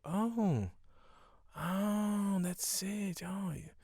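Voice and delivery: mock revelation. Friend acts out sudden enlightenment.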